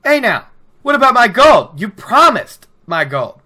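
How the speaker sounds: high, loud mock angry demand; hammed-up greedy ferryman protest. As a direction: call out for your gold, half indignant. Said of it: "Hightower is getting angry".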